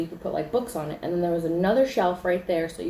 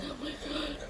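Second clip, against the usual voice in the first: high, very soft gasped words; hushed horror; 9 semitones higher, 12 dB quieter than usual. Retold or imitated. Hearing the door shut behind, hushed horror. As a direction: squeeze it out in a small high voice.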